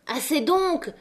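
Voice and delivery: high-pitched protest; crying out. A narrator's reading. La Maheude trying to stop the women wrecking everything, crying out. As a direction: call out to make them stop. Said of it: "cria la Maheude".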